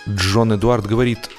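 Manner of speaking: usual speaking voice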